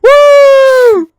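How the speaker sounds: very loud, high celebratory shout; screamed triumphant cheer; wild cheering. Real, not acted. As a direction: whoop like your side just won. Mock cheer at a reference.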